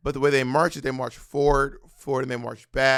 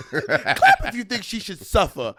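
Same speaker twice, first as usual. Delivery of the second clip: higher, slow, laughing through it; imitating Wendy Williams, laughing; 6 semitones higher than usual. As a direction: stretch it out while cracking up. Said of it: "She just goes".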